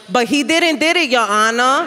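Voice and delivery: put-on thick Puerto Rican Bronx accent on a lawyer; a Bronx girl grown up, pleading the case. Comic imagining her lawyer, lightly Bronx Puerto Rican. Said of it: "your lawyer's like".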